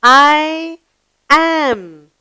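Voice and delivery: very slow, deliberate reading of "I am a lion"; slow model reading. Shown as a demonstration. Teacher reads the lion's sentence slowly to pupils. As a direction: read it slowly, word by word. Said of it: "the lion says".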